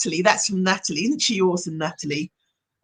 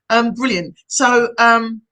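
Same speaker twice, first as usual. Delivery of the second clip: usual speaking voice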